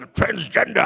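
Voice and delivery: ordinary talk, nothing marked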